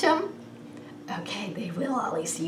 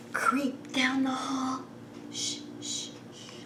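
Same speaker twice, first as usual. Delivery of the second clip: hushed storytelling whisper; sneaky whisper; 7 dB quieter than usual. Acted. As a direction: creep along with hushed words. Animals sneaking back in.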